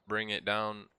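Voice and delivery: ordinary talk, nothing marked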